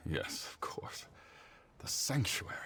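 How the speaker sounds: whispered sudden realization; realizing whisper. Acted. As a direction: whisper it to yourself as it dawns. Counselor realizes, to himself.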